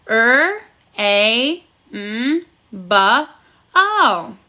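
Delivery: rainbow split into separate sounds, one at a time with pauses; sounding out phonemes. Shown as a demonstration. Teacher guiding the class to sound out "rainbow" sound by sound. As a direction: segment "rainbow" sound by sound, tapping each. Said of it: "We are going to sound out the word rainbow together".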